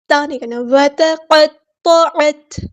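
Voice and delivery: وتقطعت slow, final ت with air released; breathy ta. Shown as a demonstration. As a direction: let breath flow on the ت. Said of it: "تا میں ہمز کی صفت ہوگی". (تا میں ہمز، سانس جاری رہے)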